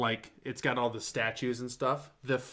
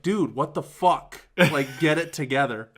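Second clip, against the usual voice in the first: slow, louder self-scolding; scolding himself; 3 semitones higher, 6 dB louder than usual. Retold or imitated. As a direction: snap at yourself to pull it together, slowly. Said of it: "I was like".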